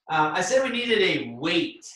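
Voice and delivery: usual speaking voice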